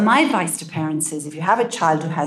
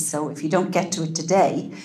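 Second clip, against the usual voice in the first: ordinary delivery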